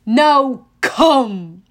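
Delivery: loud barked command; shouted order. A narrator's reading. Cyclops shouting at Grover to return. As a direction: shout him back inside. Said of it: "he shouted".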